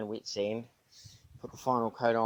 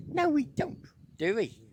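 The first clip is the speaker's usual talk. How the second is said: cooing at dog; 10 semitones higher, 8 dB louder than usual. Real, not acted. Owner coos at his dog about going overboard, high and sing-song. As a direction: coo the question at the dog.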